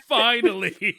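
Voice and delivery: high, loud words through real laughter; laughing hard. Real, not acted. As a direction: crack up mid-sentence, voice riding up.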